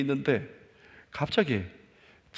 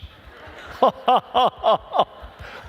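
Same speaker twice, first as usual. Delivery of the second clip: loud burst of laughter; 9 semitones higher, 7 dB louder than usual. Real, not acted. Preacher laughs at his own story.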